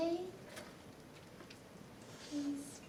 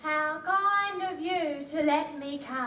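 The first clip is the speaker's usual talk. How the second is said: quick, lower Cockney-vowelled copy; Cockney repeat; 7 semitones lower, 5 dB quieter, faster than usual. Acted. Eliza trying the line, Cockney vowels. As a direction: rattle it off in Cockney. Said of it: "No, no, no, Eliza".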